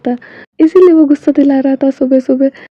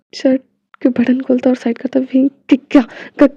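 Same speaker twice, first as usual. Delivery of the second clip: usual speaking voice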